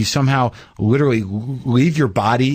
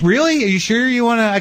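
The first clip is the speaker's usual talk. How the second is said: much higher, faked disbelief; mock surprise. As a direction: pretend you're shocked. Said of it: "And I'm like".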